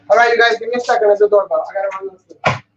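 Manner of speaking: normal talking voice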